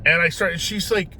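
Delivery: ordinary delivery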